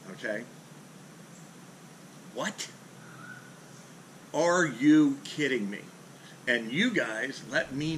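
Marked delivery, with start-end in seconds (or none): 2.3-5.8 slow, exasperated disbelief; incredulous. Real, not acted. Vlogger ranting about rivals' easy views, incredulous.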